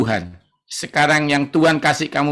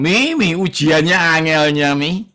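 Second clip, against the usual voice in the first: put-on whining child; 3 dB louder than usual.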